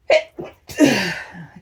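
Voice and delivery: loud real sneeze; a full burst of a sneeze. Real, not acted. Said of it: "je vais rééternuer".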